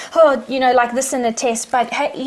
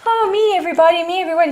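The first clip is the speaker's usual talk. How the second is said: pitched up high, mocking the me-first crowd; mock self-centred voice; 6 semitones higher, faster than usual. Guest mocking people who only help themselves, high mock voice.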